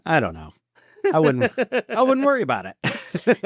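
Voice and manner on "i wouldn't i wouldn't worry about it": high, laughing through the words. Real, not acted. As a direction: brush it off while cracking up. Cracking up at co-host's nerd question.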